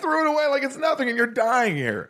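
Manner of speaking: high-pitched talk through laughter; laughing. Real, not acted. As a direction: crack up while getting the words out. Host cracks up at story.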